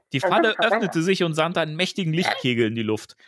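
ordinary delivery

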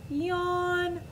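mock yawn. Sloth yawns.

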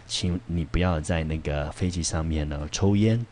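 normal talking voice

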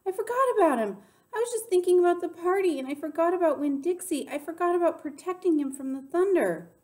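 high, loud, frantic outburst; panicked. A narrator's reading. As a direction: blurt it out in rising panic. Panicked narrator realises dog is missing.

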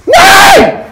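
sudden furious high scream; rage scream. Real, not acted. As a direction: explode in gamer rage. Gamer raging at getting killed.

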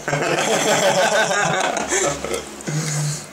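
amused high laugh. Real, not acted. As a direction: laugh out at the answer. Laughs at guest's answer.

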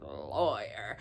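disdainful retold "Lawyer", dropped low; imitated disgust. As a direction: drag out "Lawyer" with contempt, imitating him. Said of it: "he said it with disgust".

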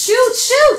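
high, very loud panicked scream; shrieked plea to fire; screamed command. Acted. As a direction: scream it like a cornered woman urging a shot. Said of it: "Lisa, uh, screams".

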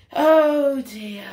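big, drawn-out storyteller's concern; loud dismay. Pretend. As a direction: announce the trouble with full voice. Narrator reacts louder.

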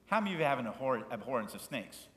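ordinary talk, nothing marked

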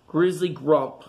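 ordinary delivery

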